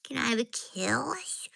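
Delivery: slow, high cooing pet talk. Real, not acted. Woman cooing slow baby talk at her dogs. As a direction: coo slowly at the dogs.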